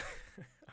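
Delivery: brief breathy chuckle; a small laugh to yourself; short chuckle. Real, not acted. Reader balking at the dull passage, short chuckle.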